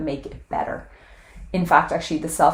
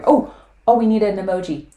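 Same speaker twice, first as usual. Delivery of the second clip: higher, louder startled oh; sudden realization; 5 semitones higher, 7 dB louder than usual. Real, not acted. Interrupting her own goodbye to viewers, surprised. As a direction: caught by a sudden thought. Sudden idea mid sign-off.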